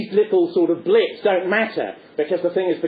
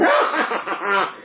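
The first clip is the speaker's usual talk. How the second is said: madman cackle; 3 semitones higher than usual. Acted. Punch capers after downing Judy, mad mock cackle. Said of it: "capers about like a madman".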